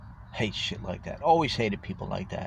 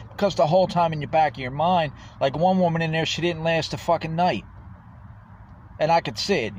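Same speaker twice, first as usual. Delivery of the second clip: heated; 7 semitones higher than usual. Real, not acted. Ranting about homeless experiment.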